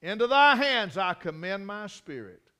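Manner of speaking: huge high shout on "Father", held loud after; Jesus. Retold or imitated. Preacher enacting Jesus' final words from the cross, loud cry. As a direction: bellow it to heaven, a victor's cry. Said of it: "With a loud voice, he cried".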